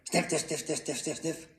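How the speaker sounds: quick, evenly pulsed sniffs; sniffing noises. A narrator's reading. Moose smelling another carrot, rapid sniffing. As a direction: whiff the words out in rapid rhythm.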